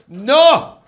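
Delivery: loud, high acted refusal; firm refusal. Counter guy turning down the customer's offer, loud and firm. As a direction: cut him off with one loud word.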